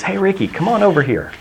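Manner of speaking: high, easy cop calling him over; friendly cop call. Retold or imitated.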